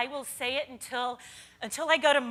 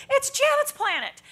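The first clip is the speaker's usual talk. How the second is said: high excited squeal, much higher and louder; gushing fan; 13 semitones higher, 6 dB louder than usual. Retold or imitated. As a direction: gush it like a starstruck fan. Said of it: "a young lady came up and she goes".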